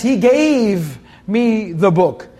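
"gave" drawn out long and slow; stress on gave. Shown as a demonstration. Teacher showing listeners the past tense, "gave" stressed and stretched. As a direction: stretch the word "gave" to mark it. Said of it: "That's past tense".

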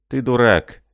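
ordinary delivery

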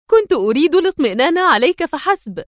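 high, agitated self-defence; flustered, edgy. A narrator's reading. Woman defending herself to the angry man, flustered. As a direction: protest nervously, pitched well up. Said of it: "فأجابته في عصبية وارتباك".

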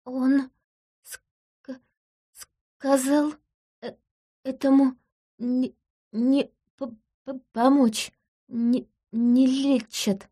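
slow, high, broken on each word; halting stutter. Acted. A wife who cannot speak stammers out her husband's words. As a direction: stammer your way through, repeating syllables. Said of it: "Заикание лечится прекрасно".

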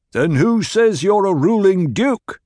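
high, loud and slow sneering challenge; defiant demand. A narrator's reading. Cornered Sardaukar challenging Paul's rank, defiant demand. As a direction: throw the question back in his face. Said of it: "the man demanded".

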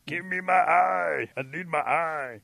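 high, loud, slow plaintive wail; smashed little possum. Acted. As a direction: wail slowly like a flattened critter. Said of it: "from that little smashed possum, you hear".

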